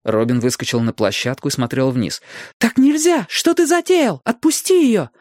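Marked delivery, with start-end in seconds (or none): 2.6-5.1 high, urgent female voice; protest, pleading him to stop; alarmed woman. A narrator's reading. Robin protests the violence.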